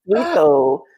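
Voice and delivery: high slow cooing gush; cooing sweet voice. Retold or imitated. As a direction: gush over him mockingly. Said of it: "Be like".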